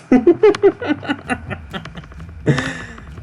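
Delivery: high-pitched burst of laughter; high giggle. Real, not acted. Laughing at own joke.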